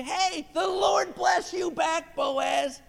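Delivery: high, drawn-out joyful shout; hearty returned greeting. Acted. Workers calling back to Boaz, high hearty shout. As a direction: holler the blessing back across the field.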